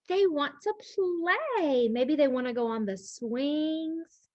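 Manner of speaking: plain reading voice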